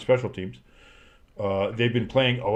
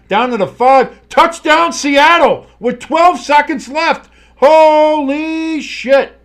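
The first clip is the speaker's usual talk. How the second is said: much higher and louder, stretched yell of disbelief; excited touchdown call; 11 dB louder, slower than usual. Real, not acted. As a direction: explode as the touchdown lands. Last-second touchdown call.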